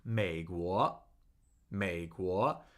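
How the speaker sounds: 美 low and dipping, 国 sweeping upward; tone demonstration. Shown as a demonstration. Teacher repeating United States, 美国 low then rising. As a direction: say 美国 as third tone plus second tone. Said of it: "Third tone, second tone".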